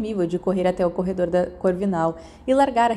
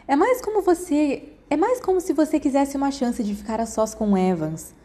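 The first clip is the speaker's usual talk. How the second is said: teasing; 7 semitones higher than usual. A narrator's reading. Sirius teasing James.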